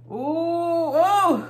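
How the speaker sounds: high, drawn-out teasing ooh. Real, not acted. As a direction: tease them before the hot take. Teasing before hot take.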